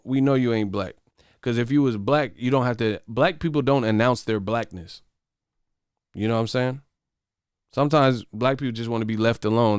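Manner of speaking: ordinary talk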